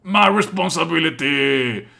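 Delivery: slow, pompous mock echo of his line; mocking imitation. Streamer mocking the character's pompous line, slow sneer. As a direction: parrot him to make him sound self-important.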